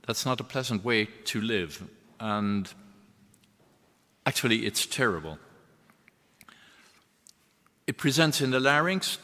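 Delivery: ordinary talk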